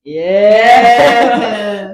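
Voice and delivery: long, drawn-out loud yeah; loud cheer. Real, not acted. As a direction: cheer it and stretch the word out. Cheers at shirtless character.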